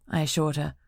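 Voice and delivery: ordinary delivery, nothing marked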